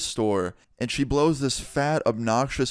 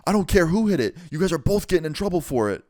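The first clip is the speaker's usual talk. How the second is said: high-pitched mock outrage; scolding manager; 6 semitones higher than usual. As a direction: imitate a manager laying down blame. Manager scolds both in acted quarrel.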